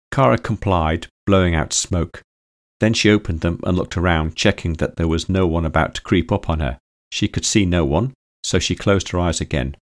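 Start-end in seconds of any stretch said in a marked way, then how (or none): none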